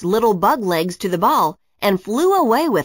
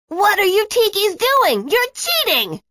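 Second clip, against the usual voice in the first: put-on much higher, indignant kid's outburst; boy yelling at Zeke for ruining the game; angry yell; 12 semitones higher than usual. A narrator's reading. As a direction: accuse them at the top of your voice. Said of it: "Justin yelled at Zeke".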